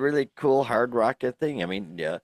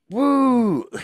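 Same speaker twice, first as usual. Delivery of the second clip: excited cheer; 13 semitones higher, 8 dB louder than usual. Real, not acted. Cheering at Iron Maiden news.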